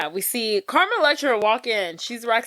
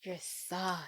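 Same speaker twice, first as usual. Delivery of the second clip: flirty seductress; 13 dB quieter than usual. Retold or imitated. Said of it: "she's like".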